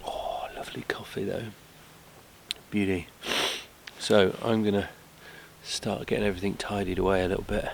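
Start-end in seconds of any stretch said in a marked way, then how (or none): 0.1-3.1 soft whispered delight; whispering. Real, not acted. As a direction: breathe it out in a whisper. Whispered over the coffee.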